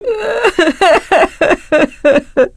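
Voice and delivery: high, long peal of laughter. Acted. Character laughing at a joke, high rippling laugh.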